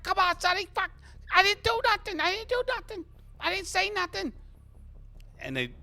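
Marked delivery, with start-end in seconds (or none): 0.0-4.3 high, loud squealing protest; squeaky, panicky small-time crook; pleading innocence. Acted. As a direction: protest as you're being hauled off. Mo protests while being grabbed.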